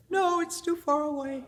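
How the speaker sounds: stuck on the rock, whining back at her friend; tearful refusal. Retold or imitated. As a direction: plead like a scared crying child. Frozen climber refusing, crying.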